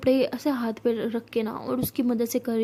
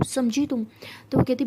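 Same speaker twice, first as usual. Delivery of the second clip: plain reading voice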